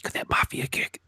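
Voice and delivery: mock ASMR whisper. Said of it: "A S M R".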